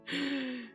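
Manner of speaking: sharp high gasp; excited gasp. Real, not acted. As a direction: gasp in delight. Greeting raiders, gasps.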